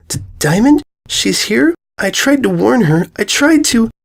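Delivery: a filly desperate for her friend; terrified filly learns her friend is in danger; distraught filly. Acted. Filly hears her friend is captured.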